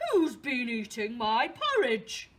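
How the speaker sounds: big, booming Father Bear shout; loud, indignant storybook bear. Acted. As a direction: boom it out as loudly as an angry father bear. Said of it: "a very loud voice".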